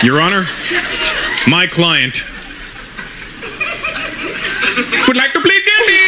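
put-on courtroom lawyer with an Indian accent, jumping high at the end. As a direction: plead the case in a comic Indian accent. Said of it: "pop in the Indian accent".

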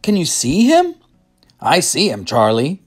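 high, eager exclaiming; excited. A narrator's reading. Grandpa Joe spotting the little man with Charlie, excited. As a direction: call out with rising excitement. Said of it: "said Grandpa Joe excitedly".

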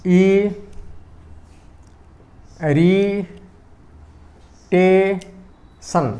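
"ଇ, ରି, ଟେ, ସନ୍" as four separate syllables, long pauses between. Shown as a demonstration. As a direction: break "ଇ, ରି, ଟେ, ସନ୍" apart, one beat at a time. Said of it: "ମୁଁ ଯେତେବେଳେ ଉଚ୍ଚାରଣ କରୁଛନ୍ତି".

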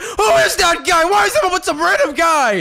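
high-pitched outraged shout; angry. Acted. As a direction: yell it in jealous disbelief. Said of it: "Why are you so angry?".

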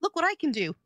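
put-on high, loud little boy showing off; excited kid voice; proud child voice. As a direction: play the proud kid bragging. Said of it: "And he goes".

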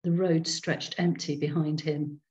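ordinary delivery, nothing marked

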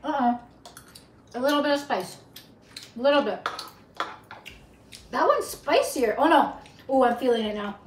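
chewing spicy food; talking between bites; mouth full. Real, not acted. Seen 1: Woman spoons red pasta into mouth at 1.0–1.6s, chews after.

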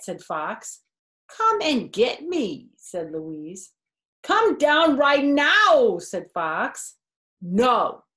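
lively storybook voice with character quotes; reading to children. A narrator's reading. Narrator reading a picture book to kids, lively storytelling. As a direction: read it aloud to a child, bright and animated.

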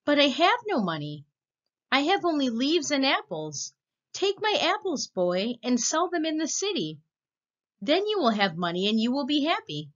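put-on high, eager tree voice calling the boy; loving tree. Tree offers apples.

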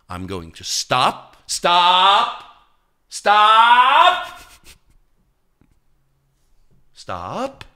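slow start rising to loud high stops, then easing; building stop. Retold or imitated. Ordering himself to pause, building loud stops. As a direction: hammer each stop harder, then release. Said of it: "And I'm able to go".

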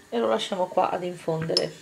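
ordinary talk

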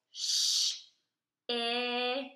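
Sh hissed, then In held, as separate sounds; teacher modelling segmenting; sounds segmented. Shown as a demonstration. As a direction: break shin into Sh and In. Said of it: "Tell me the sounds you hear in shin".

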